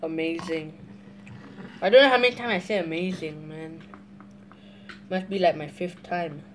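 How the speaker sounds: eating noodles mid-sentence; talking while chewing. Real, not acted. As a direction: mouth partly full of noodles. Seen 1: Chews noodles between forkfuls; mouth busy throughout the stretch.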